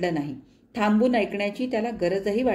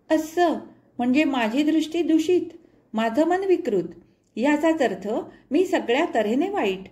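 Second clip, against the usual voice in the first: hurt outburst; 5 semitones higher than usual. A narrator's reading. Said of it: "बोलता बोलता तिला रडू कोसळलं".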